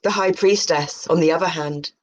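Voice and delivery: ordinary talk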